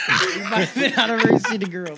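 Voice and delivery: punchline broken up by laughter; laughing. Real, not acted. Cracking the city girl joke to co-hosts, laughing. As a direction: giggle through the joke.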